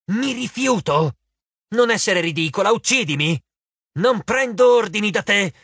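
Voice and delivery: high, emphatic quarrelling; flustered, indignant refusal. A narrator's reading. Arachne refusing Annabeth's orders, high and flustered. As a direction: refuse hotly, then get muddled and exasperated.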